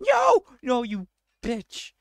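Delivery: scream, then drag out the insult; high-pitched outburst at a mishap; outraged scream. Real, not acted. Game mishap, yells at it.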